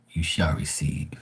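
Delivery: hushed, slow whispered blessing; soft slow whisper; solemn whisper. Real, not acted. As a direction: breathe it like a sacred promise. Solemn scripture-like closing line.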